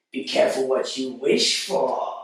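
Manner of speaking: creepy, rasping villain voice; Wishmaster. Acted. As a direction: draw out the last word ominously. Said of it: "my best Wishmaster impression".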